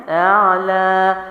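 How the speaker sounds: very slow chanted اعلی with a clear ain; Quranic recitation. Shown as a demonstration. Teacher recites from prayer to show ain, slow chant. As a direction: recite slowly in tajweed chant. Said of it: "بھی آپ کیا کرتے ہیں؟".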